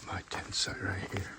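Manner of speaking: hushed whisper so others sleep. Real, not acted. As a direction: whisper softly, campers asleep nearby. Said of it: "I'm whispering 'cause a lot of people are sleeping".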